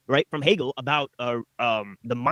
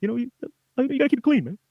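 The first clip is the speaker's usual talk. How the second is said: high-pitched Boston accent impression; put-on Boston talk, much higher; Gerald Moran; 10 semitones higher than usual. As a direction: mimic a Bostonian professor, voice pushed way up. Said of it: "So that's how he talks".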